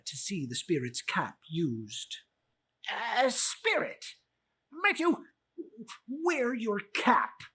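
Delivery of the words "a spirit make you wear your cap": high, loud nervous miser; stammering old Scrooge. Acted. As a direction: Scrooge stammers before the spirit.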